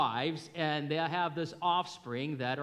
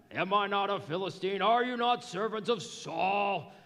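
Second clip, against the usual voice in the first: loud, higher taunting giant; Goliath taunting; 5 semitones higher, 3 dB louder than usual. Retold or imitated. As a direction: bellow a challenge across the battle lines. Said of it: "I don't know if that's his voice".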